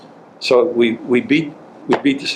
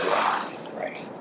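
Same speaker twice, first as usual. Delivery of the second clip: whispered dismay; 12 dB quieter than usual. Retold or imitated. Groans at being forced to interview.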